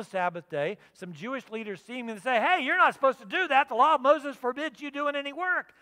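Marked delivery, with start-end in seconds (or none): 2.4-5.7 loud, higher scolding of a rule-keeper. Retold or imitated. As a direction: tell him off for breaking the law. Leaders scold the man.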